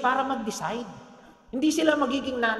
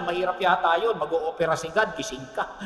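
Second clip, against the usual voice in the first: ordinary talk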